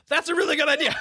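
loud, very high squeal of delight, hammed up; mock enthusiasm. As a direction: gush at a comically high pitch. Retelling own excited reply to nurse.

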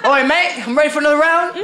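laughing hammed-up Australian accent. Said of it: "I gave Canadians Australian accents".

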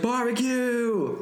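excited shout. Retold or imitated. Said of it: "I was just like".

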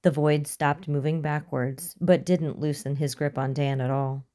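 plain reading voice

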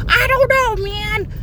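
very high, squeaky doubt; mock the nervous sidekick; whiny ghost voice. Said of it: "it's a comic relief voice".

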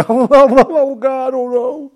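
shrill, loud falsetto panic, hammed-up; mock terror. Mocking terrified men in the film, shrieking mock panic. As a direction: shriek like a coward facing a gun. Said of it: "They're terrified of the gun".

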